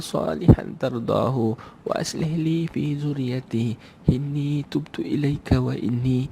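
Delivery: slow, melodic Arabic prayer chant; measured, tuneful recitation; Quranic recitation. Acted. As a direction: recite the dua with long drawn-out vowels.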